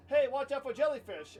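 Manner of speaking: ordinary talk, nothing marked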